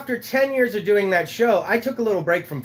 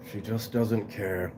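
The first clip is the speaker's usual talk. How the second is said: low muttered aside; 10 semitones lower, 11 dB quieter, slower than usual. Acted. Repeats line, slow and low.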